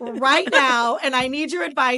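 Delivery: laughing mock demand to mom. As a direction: play the needy kid who wants it all about her. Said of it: "can you just call and be like".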